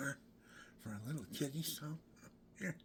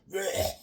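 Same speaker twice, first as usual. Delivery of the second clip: loud imitated retch, high and harsh; mock retch; 9 semitones higher, 14 dB louder than usual. Recalling the kidney stone attack, a loud retching blech. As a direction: spit out a loud gagging blech.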